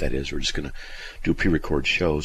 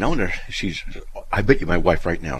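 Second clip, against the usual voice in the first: ordinary delivery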